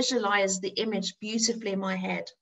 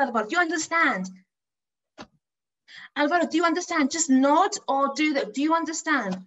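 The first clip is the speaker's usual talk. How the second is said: higher-pitched, insistent pleading; excited urging; 4 semitones higher than usual. Retold or imitated. Art teacher begs shy pupil to draw, urgent and excited. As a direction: drive every instruction home with urgency.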